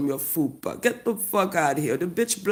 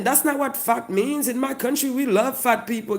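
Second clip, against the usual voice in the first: ordinary talk, nothing marked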